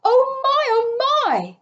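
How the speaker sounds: high, squeaky little rabbit voice; tiny high-pitched creature marvelling; awestruck rabbits. A narrator's reading. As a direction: squeal it in wonder. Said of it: "rabbits held up their forepaws and said".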